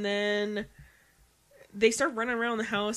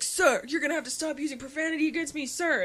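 operator voice; 5 semitones higher than usual. Retold or imitated. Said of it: "this Mister Lee character who's like".

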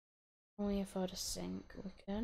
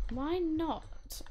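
put-on posh accent; 6 semitones higher than usual. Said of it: "I sounded really posh then".

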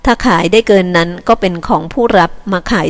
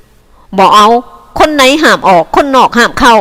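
short, loud, higher refusal; curt; 5 semitones higher, 6 dB louder, slower than usual. Acted. Cattle boss curtly refusing the villagers' meat. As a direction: cut them off bluntly. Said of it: "นายฮ้อยพูดเสียงห้วนๆ".

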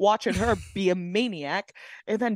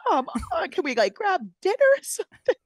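mock whiny plea; 12 semitones higher than usual. Mocking friends' whiny plea.

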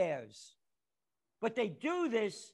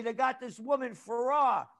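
ordinary delivery

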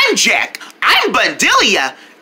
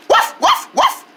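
high, short puppy barks; two quick high woofs; dog barking; 14 semitones higher than usual. Acted. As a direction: yap like a little dog. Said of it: "that did sound like a dog".

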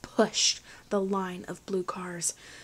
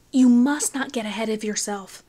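the warning repeated louder and firmer; louder admonishment; 6 dB louder than usual. A narrator's reading. Wayne admonishing Finn, louder firm warning. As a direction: press the warning harder. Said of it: "admonished Finn".

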